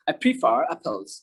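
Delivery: tuck the R of "prefer" inside the mouth; half-pronounced R. Shown as a demonstration. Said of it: "আমি ভিতরে মুখের মধ্যে রাখছি". ("prefer"-এর র মুখের ভিতরে রেখে, ধীরে)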